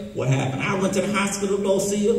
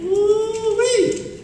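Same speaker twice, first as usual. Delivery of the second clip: whooping Ooh-wee; 14 semitones higher than usual. Acted. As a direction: let out a big hollering whoop. Puppet's signature whoop.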